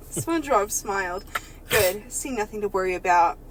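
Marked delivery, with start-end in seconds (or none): none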